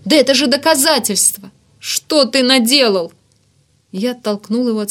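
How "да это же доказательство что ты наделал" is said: loud, high outraged protest; aghast outcry. A narrator's reading. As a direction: cry out, slowing on the final question. Диким голосом заорал Варданян. (возмущённый крик отчаяния)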